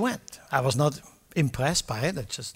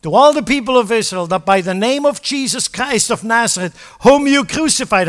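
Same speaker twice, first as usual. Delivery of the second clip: Peter's bold proclamation; 7 semitones higher, 10 dB louder than usual. Acted. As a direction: declare it boldly to the whole crowd. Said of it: "He said".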